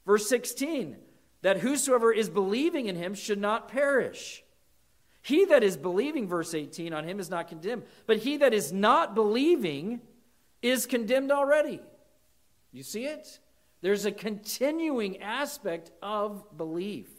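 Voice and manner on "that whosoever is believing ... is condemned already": high, loud rereading of the verses; emphatic reading. Real, not acted. Pastor rereading John to the church, emphatic. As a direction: read each "believing" with weight.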